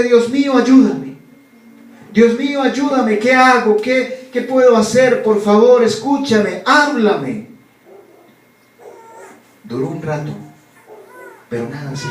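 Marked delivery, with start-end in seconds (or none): 0.0-1.2 high, loud, crying out for help; raised desperate cry; desperate plea to God. Acted. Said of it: "Comenzó a pedirle a Dios". 2.1-7.5 high and loud pleading cry; a man dangling in the dark begging God to answer; frantic raised plea; desperate prayer. Acted. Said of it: "Comenzó a pedirle a Dios".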